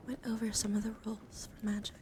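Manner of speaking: ordinary talk, nothing marked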